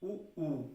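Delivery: उ, उ said twice, rounded; vowel u demo. Shown as a demonstration. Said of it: "बोल के एक बार देखिए".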